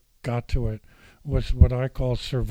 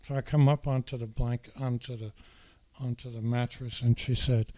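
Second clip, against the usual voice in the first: normal talking voice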